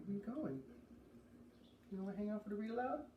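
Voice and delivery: plain reading voice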